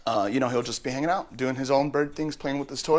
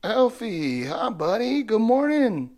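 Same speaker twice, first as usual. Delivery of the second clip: much higher, cooing hello to the bird; high pet greeting; 10 semitones higher, slower than usual. Real, not acted. Owner greeting the parrot in the morning, high cooing.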